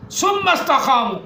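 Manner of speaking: slow, high chanted Arabic; verse recitation. Acted. Preacher quoting the verse, slow chanted recitation. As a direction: intone the Arabic slowly.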